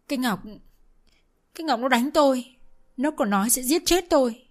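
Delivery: wailing accusation. Acted. Mother-in-law wailing accusation.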